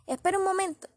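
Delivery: high, loud "wait a moment"; urgent call. Acted. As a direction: call him back before he's gone. Calls him back as she leaves.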